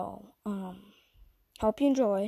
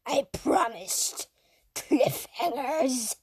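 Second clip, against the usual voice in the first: slow, eerie creepy voice; 4 semitones higher, slower than usual. Acted. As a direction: drag it out like a creepy villain. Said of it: "Sorry for the creepy voice".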